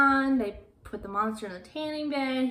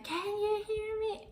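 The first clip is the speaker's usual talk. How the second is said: mock scream; 12 semitones higher, 4 dB quieter, slower than usual. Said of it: "she starts screaming".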